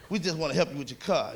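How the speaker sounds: low, soft wheedling offer; wheedling hustler. Retold or imitated. Hustler quietly coaxing a driver, low and soft. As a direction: sweet-talk him quietly into a wash.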